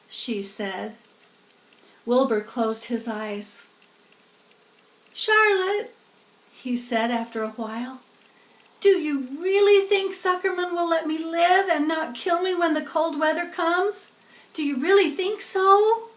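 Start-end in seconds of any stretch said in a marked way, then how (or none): 5.1-16.1 much higher, anxious begging pig; a scared young pig asking for hope; pleading piglet. A narrator's reading. Wilbur pleads for reassurance.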